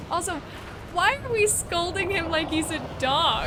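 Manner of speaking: loud, amused, half laughing; excited and amused. Real, not acted. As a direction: protest loudly while still laughing. Player laughs at being scolded.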